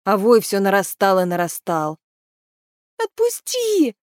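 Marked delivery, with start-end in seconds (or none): 3.0-3.9 very high, strained scream; crying out. A narrator's reading. Maeve caught in clinging bushes, high desperate cry. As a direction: scream it high, struggling to break loose. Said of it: "кричала Мэв".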